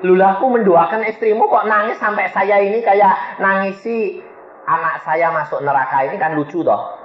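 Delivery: mildly acted confusion; bewildered. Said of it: "saya kan jadi bingung".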